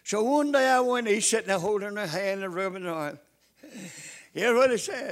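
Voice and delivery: normal talking voice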